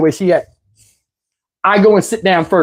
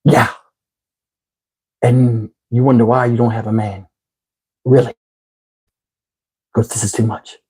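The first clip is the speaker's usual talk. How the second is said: much lower, dry put-down; flat disgust, let it drop; deadpan scorn; 8 semitones lower than usual. Retold or imitated. Teller scolding the crab woman, low dry disdain.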